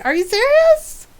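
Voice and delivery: high, rising disbelief; incredulous question swooping up high. Retold or imitated. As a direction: ask it in high-pitched mock disbelief. Retold disbelieving reaction.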